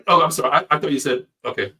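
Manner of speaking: ordinary talk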